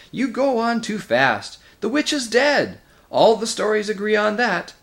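agitated scholar breaking up a quarrel; protesting old doctor. Acted. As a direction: insist on it in a high, loud voice. Figure in a story.

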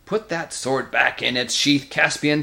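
lightly voiced angry dwarf, slow and defiant; quarrelsome Nikabrik. Figure in a story.

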